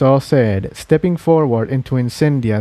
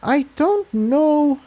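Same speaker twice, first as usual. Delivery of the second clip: slow, uncertain, much higher; hesitant; slower than usual. A narrator's reading. Tiptoe doubts the plan, slow hesitant voice. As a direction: hold back, unsure of herself. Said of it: "Tiptoe said hesitantly".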